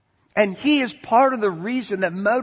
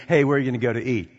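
quick casual chatter; 8 semitones lower, much faster than usual. Retold or imitated. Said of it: "instead of saying".

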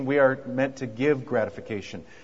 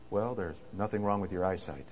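softer and lower, deadpan and thoughtful; 7 semitones lower, 10 dB quieter than usual. A narrator's reading. Husband answering his wife's mirror question, soft and thoughtful. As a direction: deliver the punchline gently, like a careful compliment. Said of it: "he says in a soft, thoughtful voice".

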